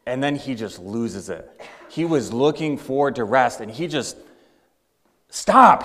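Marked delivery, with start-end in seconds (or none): 5.3-5.8 sudden high, loud yell; yelling stop. Acted. Dad yells at his bickering family, loud. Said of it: "He yells at his family".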